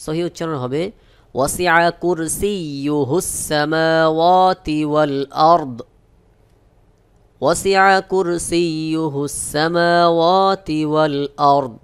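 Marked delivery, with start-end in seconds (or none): 1.4-11.7 slow chanted recitation; teacher models the verse in slow tajweed; Quranic recitation. Shown as a demonstration. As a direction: recite it slowly and melodiously.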